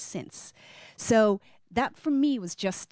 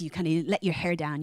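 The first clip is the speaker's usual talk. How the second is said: ordinary delivery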